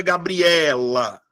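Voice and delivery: "Gabriela" slowly, with E drawn out, gabri-EEE-la; stress on E. Shown as a demonstration. Teacher offers students the right option, stress on E. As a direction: stretch the E of "Gabriela". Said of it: "qual é a sílaba que eu falo mais forte".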